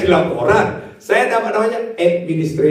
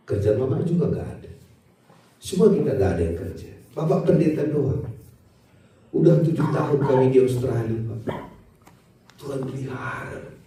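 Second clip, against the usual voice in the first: put-on soft tender children's voice; 6 semitones lower, 7 dB quieter than usual. Anak-anak berbicara kepada ayahnya.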